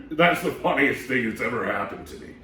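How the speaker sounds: put-on lively read-aloud voice for kids; storybook reading. A narrator's reading. Storytime host reading a picture book to kids, lively and animated. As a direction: read it to the children with big character voices.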